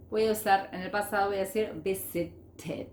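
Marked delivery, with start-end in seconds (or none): 1.8-2.9 "visited" slowly, ending in a separate id syllable; visi-ted, last syllable set apart. Shown as a demonstration. Teacher showing the third ending, exaggerated id. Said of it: "puede pronunciarse como id".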